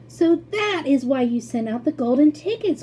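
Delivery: put-on high, loud, eager boy's cry; excited cry. A narrator's reading. Young Charlie crying out excitedly to Mister Wonka. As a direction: Charlie bursting out with the realization. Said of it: "cried Charlie".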